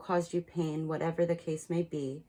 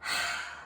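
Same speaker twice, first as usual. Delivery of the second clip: soft releasing exhale; deep breath sigh; 5 dB quieter than usual. Shown as a demonstration. Reader showing listeners a deep breath, sighing it out. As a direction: let the whole breath go, voiceless. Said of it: "Take a deep breath".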